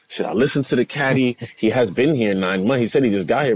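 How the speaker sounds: ordinary delivery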